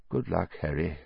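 soft low murmur. A narrator's reading. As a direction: murmur it gently to the baby. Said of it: "he murmured".

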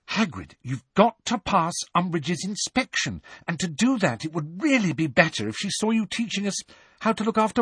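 high, sincere, slowing on the end; earnest plea. A narrator's reading. Hermione urging Hagrid to teach safe things, earnest and high. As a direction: plead sincerely with a friend. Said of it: "said Hermione earnestly".

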